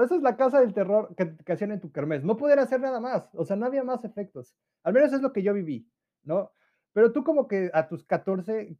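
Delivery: usual speaking voice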